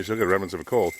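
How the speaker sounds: ordinary talk, nothing marked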